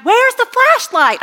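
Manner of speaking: high, slow calling out across the house. Retold or imitated. Girls at night calling out for the flashlight. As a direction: yell it out like a teen at night.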